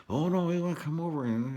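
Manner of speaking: high, pushy mocking voice; mock eager visitor. People insisting on seeing the new house, mock eager. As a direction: imitate people begging to visit.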